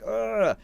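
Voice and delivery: high ugh; pained grunt. Retold or imitated. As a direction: grunt as you land hard. Thrown out into grass.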